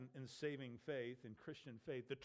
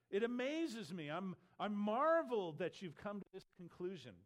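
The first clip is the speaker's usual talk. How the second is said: higher, louder astonishment; amazed; 4 semitones higher, 5 dB louder than usual. Acted. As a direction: marvel aloud, punching "marveled". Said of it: "It's amazing, he says".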